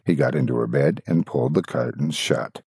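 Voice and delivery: usual speaking voice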